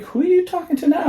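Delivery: high, fast, puzzled Shannon; imitating Shannon. Imitating friend Shannon asking who she texts, high and fast. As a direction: toss it off like a baffled friend. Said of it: "Shannon would be like".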